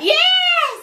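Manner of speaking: high loud yelp of agreement; excited yes. Real, not acted. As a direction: burst out with a thrilled agreement.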